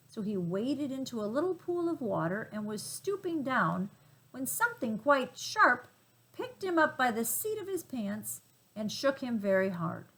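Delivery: ordinary delivery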